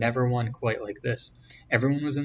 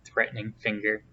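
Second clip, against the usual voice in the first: plain reading voice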